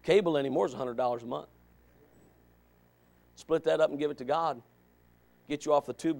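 usual speaking voice

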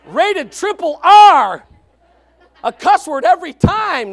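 very loud, high, drawn-out brag; hammed-up kid's delight, blasting it out; gleeful boasting. Church kid bragging about a forbidden movie, gleefully loud.

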